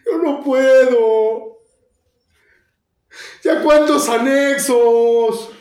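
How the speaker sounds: grito desesperado, más agudo, lento; gritando como loco. Retold or imitated. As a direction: clama a Dios a todo pulmón. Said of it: "empiezo a gritar como loco". (a gritos, suplicando a Dios en la calle)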